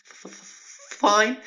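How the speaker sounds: breathless stutter then a high, loud fine; shaking nervous f-f-fine; terrified stammer. Retold or imitated. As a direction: stammer out of sheer nerves. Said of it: "and I'm shaking".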